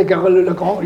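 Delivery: Gary Lagrange said the French way, with a throaty French r; Gary Lagrange with a showy French accent, r rolled in the throat; pretentious French accent. Shown as a demonstration. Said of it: "say it with a pretentious French accent".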